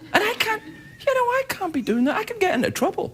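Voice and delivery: high, flustered babble; tripping over his words, voice climbing high. Real, not acted. Host losing focus on stage, flustered and high. Flustered rambling, much higher.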